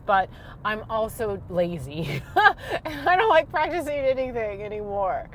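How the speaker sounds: usual speaking voice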